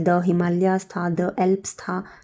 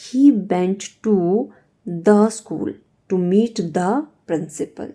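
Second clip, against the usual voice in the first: each chunk said slowly with pauses between; slow, spaced-out dictation to students; much slower than usual. Shown as a demonstration. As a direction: read it out slowly, piece by piece, for the class. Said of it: "ये तो आप सबको ही आता होगा".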